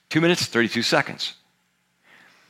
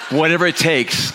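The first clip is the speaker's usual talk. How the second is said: higher, louder preaching punch; emphatic repeat; 5 semitones higher, 4 dB louder than usual. Real, not acted. Preacher repeating the church's motto to the congregation, emphatic. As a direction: drive WHATEVER IT TAKES home to the room.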